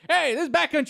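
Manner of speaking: high, loud call across a table; imitated yell. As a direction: call out like hailing a friend. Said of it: "yells at me from across the table".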